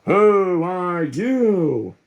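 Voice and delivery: slow, drawn-out leader's question; slow demanding voice. Acted. Gang leader questions the stranger, slow and imposing. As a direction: stretch it out, imposing.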